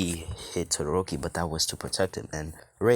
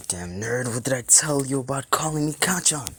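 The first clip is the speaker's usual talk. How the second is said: high, loud sneering bully; angry bully; 8 semitones higher, 5 dB louder than usual. Acted. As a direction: snarl it at the nerd. Bully Kacchan taunting in nightmare.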